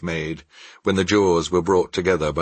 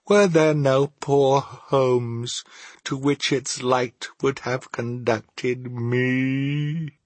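slow, mournful spectral lament; sorrowful ghost; 4 semitones higher, slower than usual. Acted. The ghost regrets ignoring the poor at Christmas, sorrowful.

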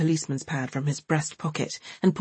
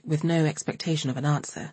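ordinary delivery

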